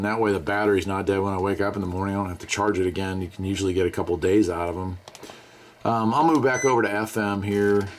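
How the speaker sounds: normal talking voice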